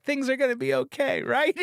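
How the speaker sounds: high, hopeful squeaky voice; high mock Angel voice. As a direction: squeak it out naively. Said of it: "He's like".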